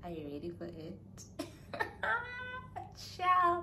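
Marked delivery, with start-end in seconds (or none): 1.0-3.1 very high excited squeal of a laugh. Real, not acted. 3.2-3.6 high, loud playful Ciao; playful shout. Real, not acted. Playful exclamation.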